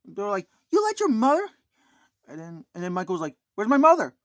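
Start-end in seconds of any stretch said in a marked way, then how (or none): 0.7-1.5 high, loud indignant demand; angry wife. Retold or imitated. Angry wife scolding Michael for his mother, high loud outrage. 3.5-4.1 high, loud panicked question; alarmed shout. Retold or imitated. Michael realizing his mother is missing, loud alarmed cry. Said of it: "he got very upset".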